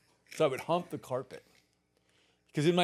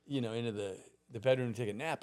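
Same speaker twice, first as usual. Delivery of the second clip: ordinary talk, nothing marked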